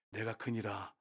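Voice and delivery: low, hushed voice of Jesus; quiet solemn declaration. Retold or imitated. Jesus revealing himself to the woman, quietly solemn. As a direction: drop low and soft for the revelation.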